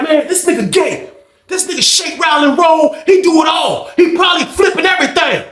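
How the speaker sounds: high taunting yell; mocking shout. Old head taunting OG to the lady, high yell.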